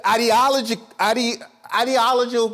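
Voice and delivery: too fast, tongue trips on "ideological"; false start, then a garbled "ideological"; tripping over words. Real, not acted. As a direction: stumble on "ideological", rushed and broken off. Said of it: "I'm going too fast".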